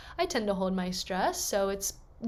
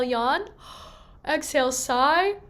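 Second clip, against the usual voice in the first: high yawn then sighing slide down; 4 semitones higher, 4 dB louder than usual. Shown as a demonstration. Coach leading a yawn-sigh exercise, yawning then sighing. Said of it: "So inhale".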